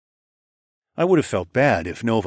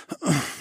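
pleasured sigh; 9 semitones higher, 4 dB quieter than usual. A narrator's reading. Aroused narrator sighs.

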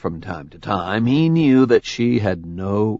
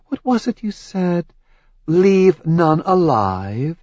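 higher, accusing goddess voice; Hera's voice; 4 semitones higher than usual. Acted. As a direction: lay out his crimes coldly. Hera accuses Zeus.

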